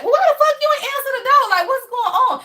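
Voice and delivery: laughing while confronting him; mock-angry girlfriend challenge; demanding. Confronting boyfriend.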